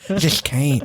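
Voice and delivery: normal talking voice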